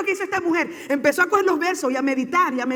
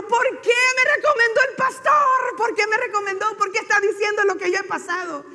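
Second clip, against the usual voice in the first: acted-out outburst, raised and dragged at first; indignant complaint; 6 semitones higher than usual. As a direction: demand to know why, pitched high. Retells woman upset at pastor.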